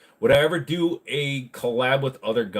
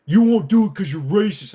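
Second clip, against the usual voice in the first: higher, louder indignant accusation; angry customer; 8 semitones higher, 7 dB louder than usual. Retold or imitated. Car buyer accusing the salesman, loud mock indignation. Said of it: "And he's like".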